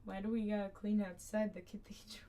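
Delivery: ordinary talk, nothing marked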